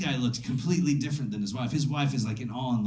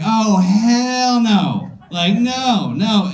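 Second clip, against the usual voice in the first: slow, high "Oh, hell no"; alarmed at the crystal dildos, slow and high; drawn-out alarm; 6 semitones higher, 6 dB louder, much slower than usual. Retold or imitated. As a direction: stretch it out, horrified. Spotting the dildo basket.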